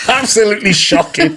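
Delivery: loud laughing self-mockery; amused disgust. Real, not acted. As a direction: burst out laughing at your own failure. Laughs at own terrible work.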